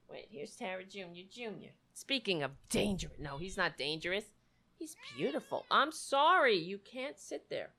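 hushed, gentle murmur to a pet; soft talk to the cat. Real, not acted. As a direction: drop way down, talking to the cat beside you. Talking softly to his cat.

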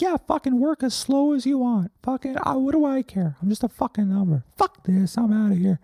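a sarcastic little number sulking and guilt-tripping; high-pitched passive-aggressive sulk from forty-four; sulky number voice. Acted. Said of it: "And forty-four is like".

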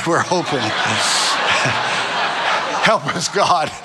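amused, through real laughter; laughing. Real, not acted. Preacher joking with congregation about hoping it works out, laughing. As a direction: laugh your way through the words.

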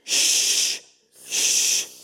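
loud, long shushing hiss; crowd's insistent shush. Acted. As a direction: hush someone sharply with a forceful shh. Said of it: "og så sier de".

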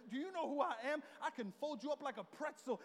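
retold trash talk. Husband asking wife "who I am", mock trash talk. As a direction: puff yourself up with mock threats. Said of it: "I just started talking trash".

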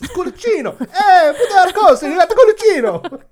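loud, high caricature Italian, laughing through it; hammed-up Italian. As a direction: shout it out like a cartoon Italian waiter.

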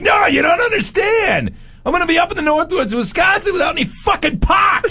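high, yelled desperate complaint; mock frantic outrage. Friend insisting he needs pot, high frantic yell. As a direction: shout it in disbelief. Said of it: "He's like".

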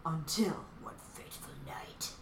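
hushed, whispery storyteller; mock-dramatic narration. Recapper hamming up the story for her friends, ominous whisper. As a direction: whisper it like an ominous trailer line. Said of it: "they don't pitch it like that".

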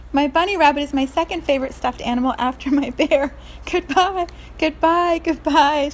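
put-on a small girl bidding her stuffed animals farewell; little girl voice. Little girl parting with her toys, high childlike voice.

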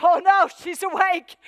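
loud, high cry of dismay; mock alarm. Enemy dreading the woman who just woke up, loud mock alarm.

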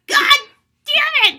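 high, loud cry of frustration; furious shout. Retold or imitated. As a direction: let the anger burst out loud and high. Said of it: "I was like".

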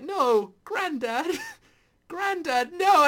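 high, loud, hammed-up cry of "Granddad" with a laugh in it; mock anguished plea. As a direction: wail at the crying old man, half joking. Retold grief at Rab crying.

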